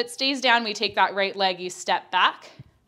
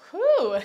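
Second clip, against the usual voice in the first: winded whew; 10 semitones higher, 5 dB louder than usual. Real, not acted. Winded mid-workout exhale.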